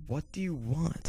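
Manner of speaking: high, soft, drawn-out mock annoyance; mock exasperation. Answering the boyfriend calling back, mock exasperation. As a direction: stretch it out high, as if fed up.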